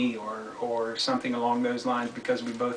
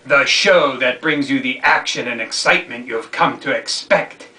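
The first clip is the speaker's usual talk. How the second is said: loud, higher-pitched dramatic reading; emphatic read-aloud; 5 semitones higher, 10 dB louder than usual. Acted. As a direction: declaim the passage like an editorial. Reads a book excerpt aloud.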